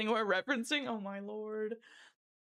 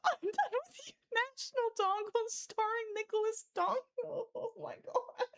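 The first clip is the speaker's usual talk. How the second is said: much higher pitched through a giggling fit; high laughing; 10 semitones higher than usual. Real, not acted. Streamer reading chat's titles, giggling high. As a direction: squeal the words out mid-laugh.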